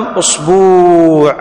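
أصبوع said us-BUUU', long held u; added long waw. Shown as a demonstration. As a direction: stretch the final vowel of أصبوع slowly. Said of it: "بزيادة الواو بعد الباء".

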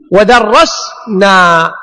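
ودرسنا drawn out slowly; teacher modelling ودرسنا; slow demo. Shown as a demonstration. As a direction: stretch ودرسنا. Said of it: "هكذا تقول".

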